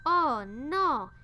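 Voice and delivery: loud dismayed cry; startled loud cry; cook cries out. A narrator's reading. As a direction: cry it out in alarm. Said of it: "cria le cuisinier".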